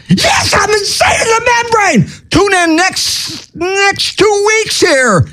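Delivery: high-pitched, shouting and laughing through the words; a crazed radio host cackling at his own jokes; over-the-top giddy rave; manic giggly host. Acted. Manic sign-off persona.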